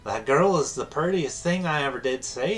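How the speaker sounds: high, swooping southern villager voice; southern accent. Acted. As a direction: gush in a high southern twang. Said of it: "I can do a southern accent".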